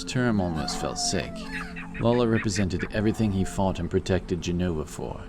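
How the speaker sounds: ordinary delivery, nothing marked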